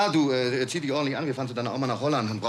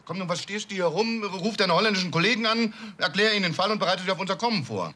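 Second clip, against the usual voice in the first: impatient orders; 7 semitones higher than usual. Acted. Father ordering his son to call the Dutch colleagues, impatient raised voice. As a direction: hurry him up with sharp orders.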